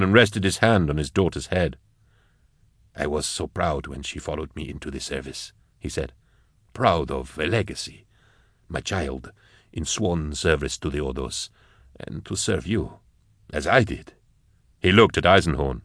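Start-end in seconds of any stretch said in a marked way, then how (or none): 3.0-5.5 low, soft father's voice; proud father Nathan. Acted. Father on his daughter joining the service, low and quiet. 6.7-14.1 low, soft father's voice; proud father Nathan. Acted. Father on his daughter joining the service, low and quiet.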